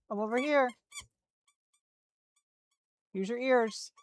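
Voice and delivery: very high coaxing calls to the dog to come find the toy; high calling voice. Real, not acted. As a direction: call the dog over, high and soft.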